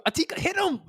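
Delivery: high-pitched mock cheer from the crowd; acting out an excited viewer urging a fighter on; rooting for her. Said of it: "I was rooting for you".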